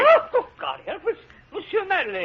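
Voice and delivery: put-on old gardener recognising the mayor, amazed and laughing; astonished recognition. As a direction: burst out in disbelieving relief. Gardener recognises his old benefactor.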